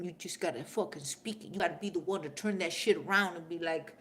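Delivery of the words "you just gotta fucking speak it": ordinary delivery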